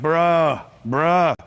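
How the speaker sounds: low, drawn-out "bruh"; disbelieving bruh. Retold or imitated. Room reacting to a huge income figure, low disbelief. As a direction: drop it low like a doubting crowd. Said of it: "Can I get that bruh sound?".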